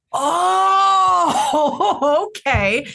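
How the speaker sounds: sudden aha moment; sudden realization. Retold or imitated. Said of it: "to go".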